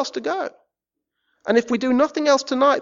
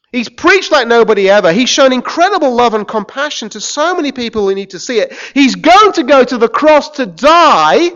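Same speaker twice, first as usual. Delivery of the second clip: loud accusing tirade ending in a yell; furious Ninevite rant; 5 semitones higher, 11 dB louder than usual. Acted. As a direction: hammer each line like an outraged accuser. Ninevites berating listeners.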